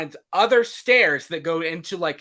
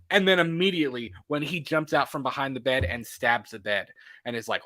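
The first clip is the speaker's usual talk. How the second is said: ordinary delivery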